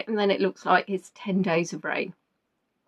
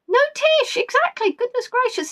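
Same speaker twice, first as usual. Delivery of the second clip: flustered exclamation; 13 semitones higher than usual. Real, not acted. Host realises she forgot someone.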